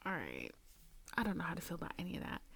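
whispering to herself, lost for words; bewildered whisper. Retold or imitated. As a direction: rush it out in a confused whisper. Unsure whisper after getting home.

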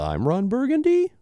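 statement turned question, pitch soaring on BURGUNDY; rising intonation. Retold or imitated. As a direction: make BURGUNDY climb high like a question. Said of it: "with the rising intonation thing".

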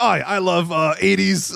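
high-pitched mock English accent drifting Scottish; bad English accent. Retelling a wrestler's promo in a bad English accent. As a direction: imitate an Australian attempting an English accent. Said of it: "sounds like an Australian guy trying to be English".

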